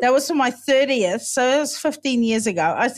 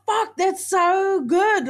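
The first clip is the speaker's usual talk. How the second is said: slow, awed thought; 7 semitones higher, much slower than usual. Retold or imitated. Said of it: "I just thought".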